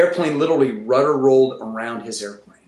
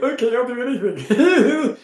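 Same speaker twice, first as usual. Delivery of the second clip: high, drawn-out mock eagerness; slow, high-pitched comic willingness; eager agreement; 9 semitones higher, slower than usual. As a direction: agree giddily with a laugh. Acts out own eager agreement.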